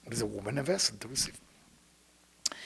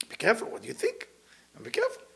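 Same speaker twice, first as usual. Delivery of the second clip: a sudden high-pitched be careful; high warning; 7 semitones higher, 4 dB quieter, faster than usual. Acted. Warning listeners about thoughts.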